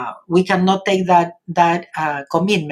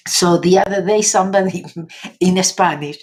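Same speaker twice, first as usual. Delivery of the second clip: ordinary talk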